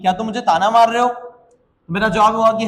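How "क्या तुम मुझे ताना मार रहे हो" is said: ordinary talk, nothing marked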